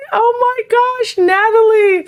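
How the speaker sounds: very high, drawn-out gasping delight; delighted surprise. Real, not acted. Streamer spotting long-unseen friend Natalie, delighted high surprise. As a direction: stretch it out high in happy disbelief. Spots an old friend in chat.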